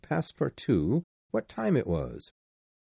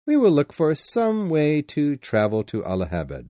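usual speaking voice

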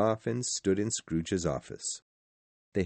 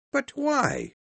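very high-pitched questioning cry; distressed cry. A narrator's reading. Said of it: "cried the nephew".

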